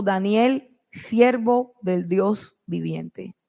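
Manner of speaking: usual speaking voice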